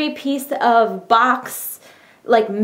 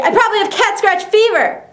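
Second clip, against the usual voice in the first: excited exclaim; 9 semitones higher, 3 dB louder than usual. Pretend. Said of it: "it's a fun song to sing".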